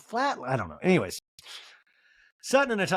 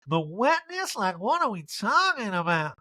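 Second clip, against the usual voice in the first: high, slow, drawn-out mock Erica; 8 semitones higher, slower than usual. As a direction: camp it up, pitched high and slow. Erica voiced in recap.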